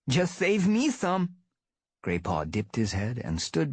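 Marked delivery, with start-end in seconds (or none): 0.1-1.4 high playful slow plea; teasing young cat. Acted. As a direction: tease him lightly, young cat. Grey Paw teasing.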